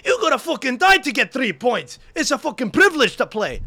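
high-pitched ranting football manager impression; Steve Warnock. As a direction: harangue the players like an over-the-top coach. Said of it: "I'm like Steve McLaren ... Steve Warnock".

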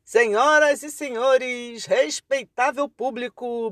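slow, booming circus announcer; ringmaster. Acted. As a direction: welcome the crowd like a ringmaster. Circus-style opening of the show.

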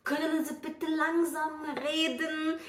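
alto, arrastado pedido em alemão; pedido lento imitado. Retold or imitated. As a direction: imitando a si mesma, palavra por palavra, bem devagar. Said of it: "Eu sempre falava assim". (pedido encenado, lento e arrastado)

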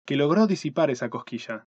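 usual speaking voice

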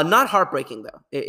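ordinary talk, nothing marked

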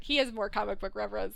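normal talking voice

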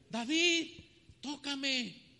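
high, loud royal command; a king ordering David to play, loud and high; Saul demanding. Acted. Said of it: "Qué hacía Saúl". (Saúl le exige a David que toque, en voz alta y aguda)

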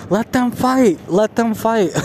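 high-pitched imitation of a yelling girl; mock yelling. Vlogger imitating a girl from a reality show, mock yelling. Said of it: "that one girl kept yelling".

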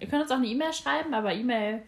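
ordinary delivery

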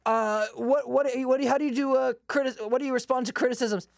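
lightly hammed-up hesitant interviewer; interviewer impression. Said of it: "and it's like".

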